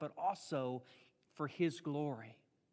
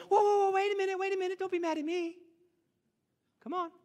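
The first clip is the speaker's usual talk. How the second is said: much higher, louder, wheedling whoa-whoa; whining plea; 8 dB louder, faster than usual. Acted. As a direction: coax and plead like a pestering kid. Strong-arming God, acted pleading.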